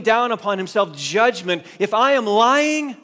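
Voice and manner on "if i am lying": loud, high, drawn-out self-curse; impassioned acted protest, voice soaring; vehement oath. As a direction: cry it out as a solemn oath. Acting Peter calling judgment on himself.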